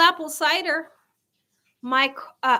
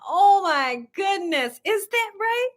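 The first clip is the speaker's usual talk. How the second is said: ordinary talk, nothing marked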